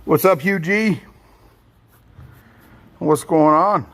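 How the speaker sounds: excited greeting. Real, not acted. Loud greeting to chat viewers.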